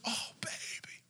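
hushed, whispered coo; tender relief. Retold or imitated. Mother seeing her lost child, whispered tenderness.

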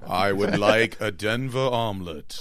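mock deep voice.

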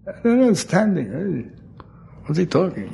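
a hint of a baffled mother from Brooklyn; confused Brooklyn mother. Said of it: "his mother from Brooklyn".

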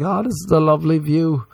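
naive American tourist. Retold or imitated. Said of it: "American tourists would show up and be like".

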